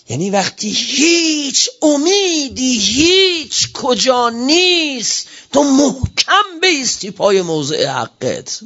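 high, slow, each word stretched; fervent preaching. Real, not acted. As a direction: rise to a passionate peak. اوج موعظه دربارهٔ ایستادگی. (با شور و کشیده)

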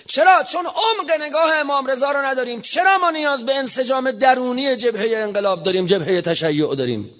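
high, forceful sermon voice; fervent preaching climax. Acted. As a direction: drive the point home to the faithful. Preacher rises to fervent peak. (اوج موعظه با صدای زیر و پرشور)

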